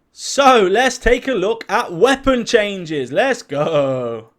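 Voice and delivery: loud, drawn-out hype announcement; excited, slow and emphatic kickoff. Real, not acted. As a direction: build hype, stretching the words. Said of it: "hear the excitement in my voice".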